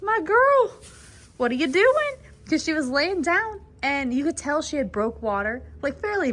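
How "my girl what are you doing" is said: high, loud startled call to the mare; shocked, raising the voice at the horse; surprised exclaim. Retold or imitated. Calling out to the laboring mare in surprise. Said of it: "I walked by and I said".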